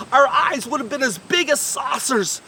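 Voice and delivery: usual speaking voice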